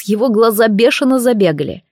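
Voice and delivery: usual speaking voice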